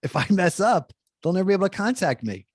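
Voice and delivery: lightly acted anxious self-talk; mild retold panic. Retold panicky thought about phone number.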